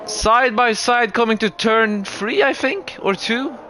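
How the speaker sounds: lively play-by-play over the race; motorsport commentator. Acted.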